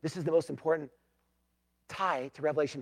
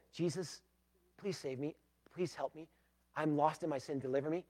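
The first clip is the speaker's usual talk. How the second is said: softer, humble sinner's plea; soft prayer; 3 semitones lower, 12 dB quieter than usual. Shown as a demonstration. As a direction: pray it quietly, as if really asking. Models a sinner's prayer softly.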